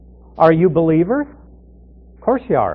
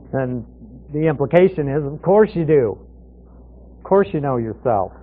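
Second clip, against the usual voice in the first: ordinary delivery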